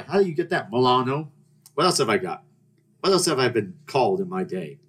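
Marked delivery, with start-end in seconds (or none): none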